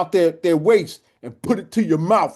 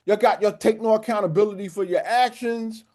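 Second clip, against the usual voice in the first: higher-pitched, insistent retold reprimand; retold scolding; 6 semitones higher than usual. Coach lecturing boys on accountability, retold scolding. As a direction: lay into them as the coach did. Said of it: "He went in".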